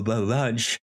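ordinary delivery

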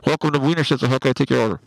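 muffled drive-thru speaker voice; muffled intercom voice. Shown as a demonstration. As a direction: talk like a fuzzy drive-thru box. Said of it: "I'll make it sound, like, real muffled".

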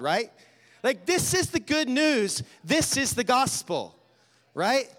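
high, loud fervent preaching; rally the room, full fire; preaching at full force. Acted. Preacher urges the crowd to take sins to the cross, fervent.

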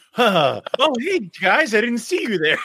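high, mock-innocent "oh, hey"; feigned surprise. Host acting out someone suddenly noticing people, feigned surprise. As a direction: pitch it up as if only just noticing them. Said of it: "and then they're like".

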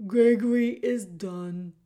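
put-on loud, booming old jailer who speaks of himself by name; the jailer Gregory greeting Meg in a big theatrical voice. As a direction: boom it out like a grand old jailer. Gregory finishes.

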